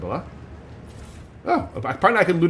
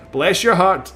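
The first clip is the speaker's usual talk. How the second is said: higher, louder mock-Southern blessing; Texan accent; 5 semitones higher, 5 dB louder, much slower than usual. Shown as a demonstration. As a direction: ham up the Texan twang. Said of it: "my reference for Texan accent".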